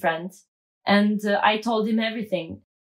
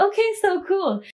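breezy okay; 8 semitones higher than usual. Retold or imitated. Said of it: "I'm like".

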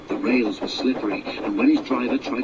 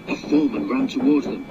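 ordinary delivery

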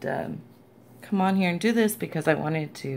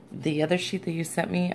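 usual speaking voice

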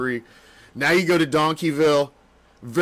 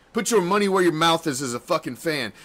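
usual speaking voice

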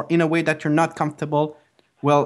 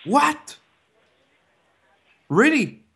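mock shocked disbelief; 10 semitones higher, slower than usual. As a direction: play up the disbelief, pitch shooting up. Caller hears department is closed.

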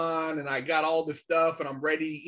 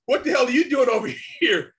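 loud, high, laughing through the cops' question; laughing incredulous; 7 semitones higher, 6 dB louder than usual. Retold or imitated. Teller voicing cops spotting three guys, laughing loud. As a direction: crack up while voicing the cops.

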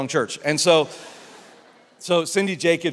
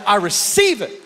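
higher, loud receiving; fervent shout; 7 semitones higher, 9 dB louder than usual. Retold or imitated. Pastor to the prophet, fervent shout. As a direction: claim it loudly. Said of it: "And I went".

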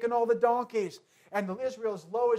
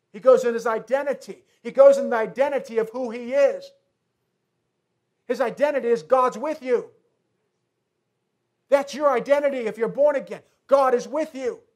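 full-force preaching; 8 dB louder than usual. Acted. Preacher driving home identity.

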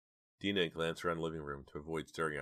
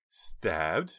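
shrieking; 3 semitones higher, 6 dB louder than usual. Acted. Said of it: "shrieked Jade".

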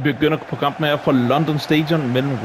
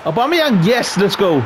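excited cheer; 7 semitones higher, 3 dB louder, slower than usual. Real, not acted. His team scores a goal.

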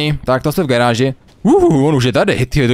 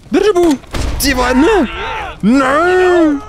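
panicked shouting; 14 semitones higher than usual. Real, not acted. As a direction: shout in panic as the fire closes in. Burning car, panicking gamer. (panický křik)

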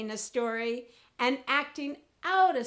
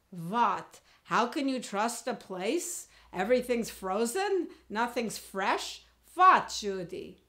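put-on Russian accent. Grandmother questioning Judy about a sperm bank, Russian accent. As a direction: play a baffled Russian grandmother. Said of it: "Russian accent".

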